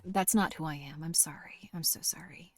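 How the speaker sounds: hushed, unvoiced apology; softly whispered regret; whispered sorry. Real, not acted. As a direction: whisper it sheepishly.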